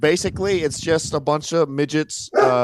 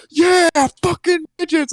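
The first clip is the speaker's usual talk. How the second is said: high-pitched whooping yell, retold; wild cheer; 14 semitones higher than usual. Retelling his cheer to coworkers at the show, wild high yell. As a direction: re-enact an over-the-top fan's holler. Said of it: "looked at me like I was insane".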